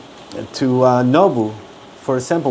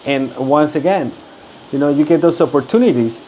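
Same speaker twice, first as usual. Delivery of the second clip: usual speaking voice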